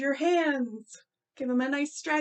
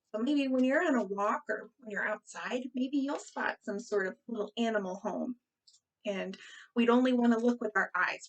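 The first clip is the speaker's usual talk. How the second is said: normal talking voice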